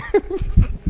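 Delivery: high breathy burst of laughter; real embarrassed laugh; high laugh at himself. Real, not acted. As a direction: laugh at your own story. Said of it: "I'm laughing at myself".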